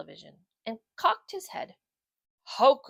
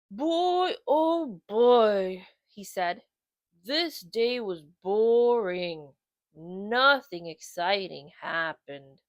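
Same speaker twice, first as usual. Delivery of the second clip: slow, loud bored dog voice; bored bulldog; 2 semitones lower, 4 dB louder, much slower than usual. Acted. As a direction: groan it out bored. Otis voiced in story.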